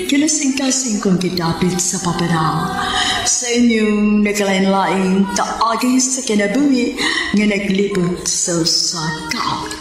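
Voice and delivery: ordinary delivery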